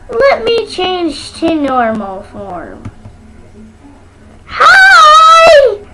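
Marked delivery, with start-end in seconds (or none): 4.5-5.8 squealed greeting, much higher and louder; excited shout. Pretend. Pretending to switch forms, a child shouts a bright hello. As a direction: burst back in as your normal self.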